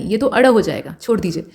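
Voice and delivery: usual speaking voice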